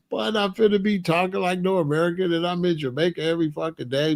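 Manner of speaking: ordinary delivery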